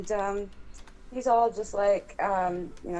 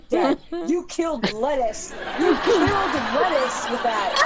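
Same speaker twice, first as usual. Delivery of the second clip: laughing through it; 5 semitones higher than usual. Retold or imitated. Laughing at dad's fart.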